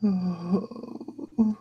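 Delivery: long, high acted moan; tearful moan. Master breaking down before his goblin, tearful moan. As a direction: let out a drawn-out mock sob. Master begins crying in the game.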